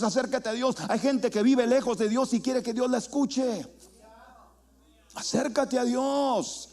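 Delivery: rising, insistent preacher's exhortation; full-force preaching. Real, not acted. Preacher urging the congregation to draw near to God, forceful preaching.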